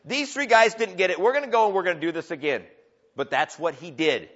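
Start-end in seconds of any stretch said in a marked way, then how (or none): 0.0-2.7 higher, louder hammed-up king ordering the band; mock proud king. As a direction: bark the order like a pompous king. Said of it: "You don't say".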